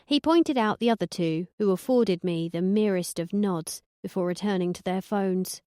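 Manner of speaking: ordinary delivery, nothing marked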